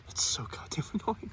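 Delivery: whispered, breaking into a laugh; amused whisper of exasperation. Real, not acted. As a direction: whisper it and crack up halfway. Repeated in a whisper.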